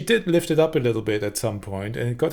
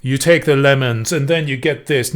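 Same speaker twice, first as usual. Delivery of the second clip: louder, heavy, pushing each word; pushy loud delivery; 7 dB louder than usual. Shown as a demonstration. Coach contrasting a heavy pushy delivery with light gliding, loud. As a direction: shove the words at the audience. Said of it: "So it's not like".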